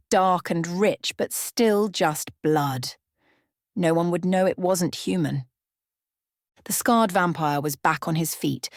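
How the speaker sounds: plain reading voice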